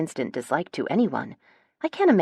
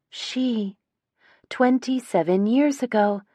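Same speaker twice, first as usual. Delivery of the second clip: higher, halting, stunned; dawning shock; 7 semitones higher, slower than usual. A narrator's reading. Sister pieces together the affair, stunned. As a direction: echo it in disbelief.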